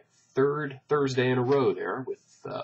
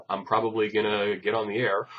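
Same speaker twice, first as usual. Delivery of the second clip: ordinary talk, nothing marked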